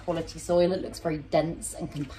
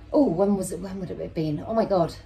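ordinary delivery

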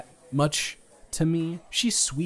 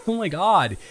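laughing; 7 semitones higher, 6 dB louder than usual. Real, not acted. Laughs at listener's reaction.

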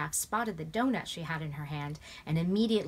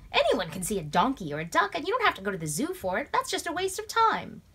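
louder scoffing that rises much higher; scornful; 8 semitones higher, 6 dB louder than usual. A narrator's reading. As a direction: dismiss it as a waste of time. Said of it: "Tom scoffed".

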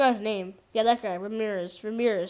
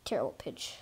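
breathy whisper, much softer; 12 semitones lower, 13 dB quieter than usual. Real, not acted. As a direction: whisper it under your breath. Muttering at a bad pitch.

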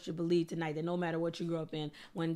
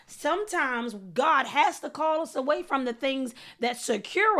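higher, driving sermon delivery; raised preacher's voice, punching key words; impassioned preaching; 5 semitones higher, 5 dB louder than usual. Real, not acted. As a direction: preach it with mounting force.

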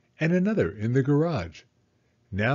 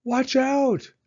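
much higher, louder alarm cry; cried warning; 12 semitones higher, 4 dB louder than usual. Acted. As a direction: shout the warning up high. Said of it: "cried Ben".

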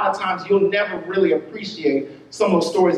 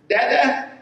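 put-on child calling dada; 5 semitones higher than usual. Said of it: "he'll say".

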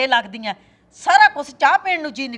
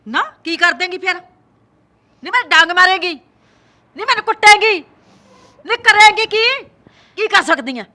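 much higher, louder taunting yell; shrieking dare; 6 semitones higher, 9 dB louder than usual. Acted. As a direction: scream the challenge at her. Mother-in-law dares her. (ਸੱਸ ਦਾ ਚੀਕ ਕੇ ਲਲਕਾਰਨਾ)

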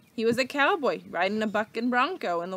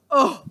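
pained grunt; 6 semitones higher, 10 dB louder than usual. A narrator's reading. Leroy lands on his back.

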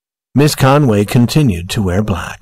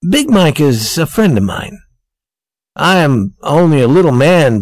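higher-pitched, animated boasting in character; Andy, proud fiancé; 7 semitones higher than usual. Acted. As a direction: talk up a powerful friend with pride. Andy boasts about his friend.

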